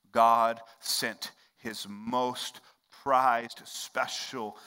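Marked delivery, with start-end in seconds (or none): none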